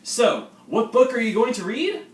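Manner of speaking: high, loud hammed-up upbeat senior; high, loud mock-cheerful older student; cheery senior. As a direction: greet the freshman like an overeager host. Skit senior lecturing kid.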